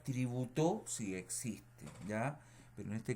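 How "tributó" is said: "tributo" stressed as tri-bu-TÓ, loud and rising; stress on last syllable. Shown as a demonstration. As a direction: push the final "to" of "tributo" up. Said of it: "¿Existe la palabra tributo?".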